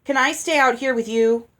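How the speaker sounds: put-on loud, eager childish question; a pushy child nagging. A narrator's reading. Olive knocking Ella's arm, loud and childish.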